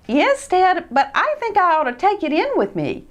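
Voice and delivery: high, uneasy little mouse voice; hesitant Christopher. Acted. Christopher unsure about leaving his new sled. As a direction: hold back, unsure.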